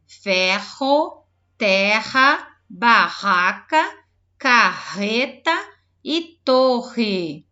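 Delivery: loud list with a strong rr; double R demo. Shown as a demonstration. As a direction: lean on every rr, slow and clear. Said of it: "E olha agora".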